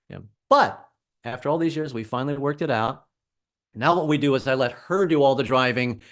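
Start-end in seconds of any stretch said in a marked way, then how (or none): none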